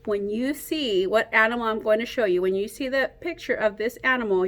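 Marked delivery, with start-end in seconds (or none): none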